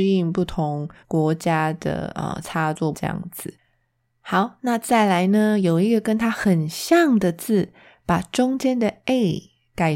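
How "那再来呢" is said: usual speaking voice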